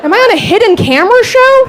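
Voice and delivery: high disbelieving question; incredulous. Real, not acted. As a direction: raise the pitch in disbelief.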